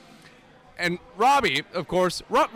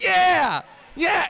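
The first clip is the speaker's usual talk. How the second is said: put-on kids cheering; 10 semitones higher, 6 dB louder than usual.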